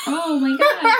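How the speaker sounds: high laughing exclamation. Real, not acted. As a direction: laugh it out loud. Reacting to fart smell.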